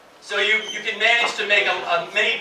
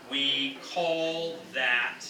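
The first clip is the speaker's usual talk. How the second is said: very slow, stretched-out words, slightly softer; slow model phrase; 5 semitones lower, 5 dB quieter, much slower than usual. Shown as a demonstration. Teacher modelling a phrase for English learners, slowly. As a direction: draw out each word for learners.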